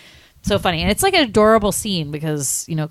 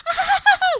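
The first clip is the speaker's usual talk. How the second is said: very high excited granny shriek; imitated squeal of delight, half laughing; flipping-out squeal. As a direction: shriek like an overjoyed little old lady. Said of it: "She, like, flips out".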